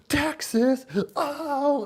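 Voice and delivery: very high, loud delighted cry; excited imitation. Vlogger imitating the cook thrilled to hear Texas, high excited cry. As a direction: squeal it like the overjoyed cook. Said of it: "He goes".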